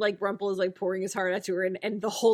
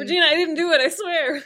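mock pleading; 7 semitones higher, 5 dB louder than usual. Mock pleading before death stare.

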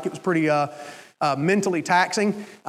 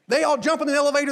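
normal talking voice

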